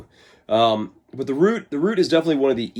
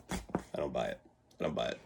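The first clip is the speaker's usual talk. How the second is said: deadpan dismissal; 10 semitones lower, 15 dB quieter than usual. Real, not acted. Dismissing hair-care myths.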